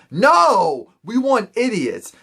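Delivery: loud, high mock outburst; high-pitched, punched-out retort; emphatic refusal. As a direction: burst out with a blunt no. Acted blunt reply to casting question.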